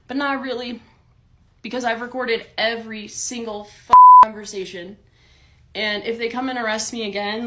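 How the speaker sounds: ordinary talk, nothing marked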